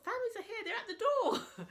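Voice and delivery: excited laughing cry. Retold or imitated. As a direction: blurt it out giggling with excitement. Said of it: "And Luke's going".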